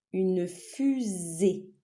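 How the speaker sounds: "fusée" slowly as FU-SÉE; syllable-by-syllable. Shown as a demonstration. Teacher naming a picture for pupils, syllable by syllable. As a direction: stretch both syllables of "fusée".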